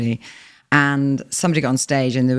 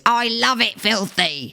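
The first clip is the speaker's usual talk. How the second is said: high, slow heckle in character; drawn-out put-on heckler voice; elderly woman heckler; 9 semitones higher, slower than usual. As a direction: imitate an old lady relishing her heckle. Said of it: "best heckle of my life from a woman".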